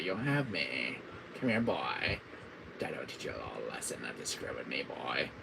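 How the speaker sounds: low, soft villain threat; quick, hushed menace at the end; menacing villain as stern dad; Wesker. Acted. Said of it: "my R E five impression".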